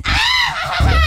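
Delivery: high shrieking witch cry. Pretend. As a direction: let out a witch's screech. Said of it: "Did you hear my witch voice?".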